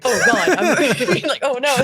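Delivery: loud, laughing mock dread; mock panic. Retelling hearing an American in public, in mock panic. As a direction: act out comic alarm through a laugh.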